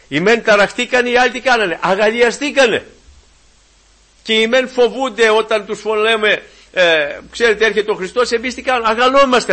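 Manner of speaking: high, loud rousing sermon; fervent preaching. Acted. Preacher rousing the congregation with joyful fervour. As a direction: stir the brethren with joy.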